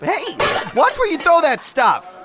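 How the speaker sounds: loud, alarmed yell; startled shout. Acted. Newbie dodges flying food, yelling at the cooks.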